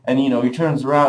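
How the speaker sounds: usual speaking voice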